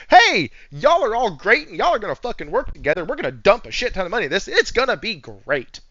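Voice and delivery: loud hyped boss voice, fast; hammed-up corporate hype; mock executive pep talk. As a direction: pump up the team like a slick exec. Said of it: "and go".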